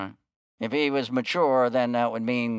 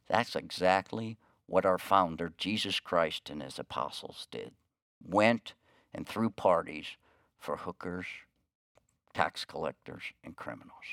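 lower, softer, gentle certainty; quiet conviction; 6 semitones lower, 7 dB quieter than usual. Acted. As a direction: calmly explain it to the manager, fading away. Pastor's quiet answer.

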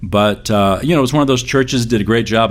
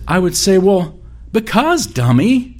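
put-on high, slow teasing old woman; mock grandmother; 11 semitones higher than usual. Preacher imitates his grandmother's sassy answer, high-pitched. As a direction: play the grandma calling him dummy, high and drawn out.

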